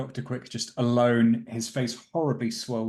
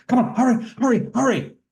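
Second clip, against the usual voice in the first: much higher and louder, a frantic urging cry; urgent hurry call; 13 semitones higher, 10 dB louder than usual. Acted. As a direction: shout them up the stairs like a panicked doctor. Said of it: "as Doctor Quick goes".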